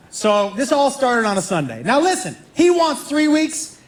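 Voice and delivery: ordinary talk, nothing marked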